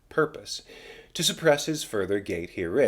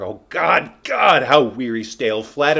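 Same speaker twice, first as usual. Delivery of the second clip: anguished outcry; 3 semitones higher, 8 dB louder than usual. Acted. Hamlet alone, crying out in weary despair, loud anguished cry. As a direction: cry out to God in weariness.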